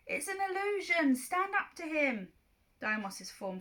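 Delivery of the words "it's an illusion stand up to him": higher, pleading shout; urgent yell. Acted. Percy yells to Clarice to resist, high urgent shout. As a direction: yell it so she hears. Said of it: "I yelled".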